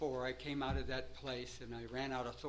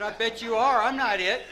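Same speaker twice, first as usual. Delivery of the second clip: loud retort; 7 semitones higher, 12 dB louder than usual. Retold or imitated. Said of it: "I said".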